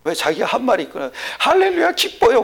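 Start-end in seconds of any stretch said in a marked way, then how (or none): 1.4-2.4 high, loud imitation of a joyful believer; hammed-up hallelujah cheer; mock rejoicing. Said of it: "막 이래놨거든". (높고 큰 소리로 기뻐하는 흉내)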